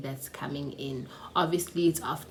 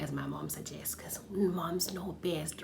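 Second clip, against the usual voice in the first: trying to be calm; 6 dB quieter than usual. Shown as a demonstration. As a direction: drop to a soft, obedient murmur. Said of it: "trying to be calm and ngikhulume kahle".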